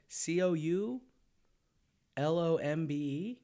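letter by letter, slowly; spelling it out. Shown as a demonstration. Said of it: "How do you spell it?".

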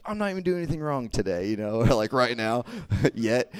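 fast, high, exasperated self-defence; indignant protest. Retold or imitated. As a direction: protest your innocence, half laughing. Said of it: "I was like".

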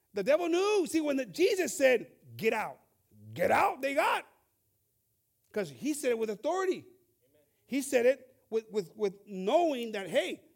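hammered sermon voice; fiery preaching. Acted. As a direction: drive it home to the congregation. Preaching at full force.

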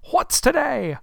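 high, loud, eager hail; calling down. Acted. Scrooge calling from window to boy below, loud eager call. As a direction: call it out from a height. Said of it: "calling downward to a boy".

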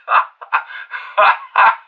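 breaking into a hearty chuckle; amused laugh. Acted. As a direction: burst of unvoiced laughter. Listener laughs at the message.

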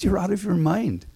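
high, incredulous brush-off. Retold or imitated. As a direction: disbelief at a wild plan. Narrator's disbelief at Bob.

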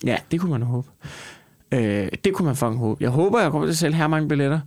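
normal talking voice

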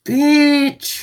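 high mock yell; hammed-up yelled insult; playful yelled bitch. Real, not acted. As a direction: fling it back at the friends. Joking retort to friends teasing.

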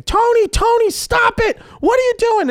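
very high, loud mock scream; imitated screaming mother. Mother yelling at dad to stop the fight, mock screaming. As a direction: shriek it like a panicked mother. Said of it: "I hear my mother screaming".

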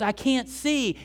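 high-pitched retold protest; protesting confession. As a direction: act out a jockey pleading his case. Jockey's acted reply in quarrel.

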